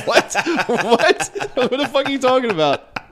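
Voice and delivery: high, incredulous repeated what; mock disbelief. Him reacting to the Irish lady's racism, high disbelief. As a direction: sound stunned and baffled. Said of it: "I was like".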